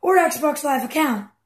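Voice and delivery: slow, evenly paced automated menu voice; phone menu recording. Retold or imitated. Mimicking Microsoft's phone menu to mock the caller, slow automated read. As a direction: spell out each option like a phone robot. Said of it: "And then they say".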